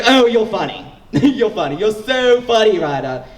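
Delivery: hammed-up condescending chuckle; mock laughing friend. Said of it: "And he was like".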